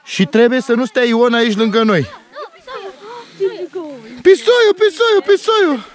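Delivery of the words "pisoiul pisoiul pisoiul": excited calling. Real, not acted. Dad spots the kitten and calls out, excited. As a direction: call out to the kitten, delighted.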